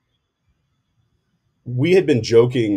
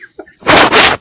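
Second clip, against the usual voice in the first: breathy burst of laughter; cracking up uncontrollably; helpless laugh; 12 dB louder than usual. Real, not acted. Laughing after spraying coffee.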